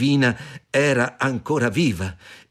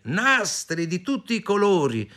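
high, lilting vendor's pitch; tempting street seller; coaxing pedlar woman; 8 semitones higher than usual. A narrator's reading. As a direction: show off the goods in a high voice. Disguised queen hawks her wares.

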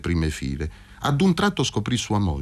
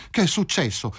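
higher, alarmed near-shout; almost shouting; 7 semitones higher than usual. A narrator's reading. Wife alarmed by news of her mother, near-shout. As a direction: blurt it out in alarm. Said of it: "quasi gridò Filomena".

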